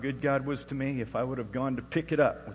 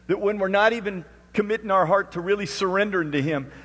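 high, loud preacher's cry; loud, high fervent preaching; full-force preaching; 8 semitones higher, 11 dB louder than usual. Acted. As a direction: preach it out to the whole room.

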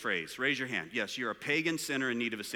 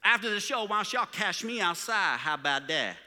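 loud, higher mock street-accent challenge; 4 semitones higher, 5 dB louder than usual. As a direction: imitate a teen throwing down a threat in street accent. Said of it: "this street accent thing".

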